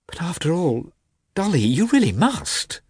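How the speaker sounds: high-pitched, tentative pleading. A narrator's reading. Husband urges reluctant wife to receive guest, pleading.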